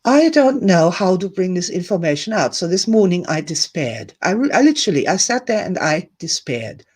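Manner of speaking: usual speaking voice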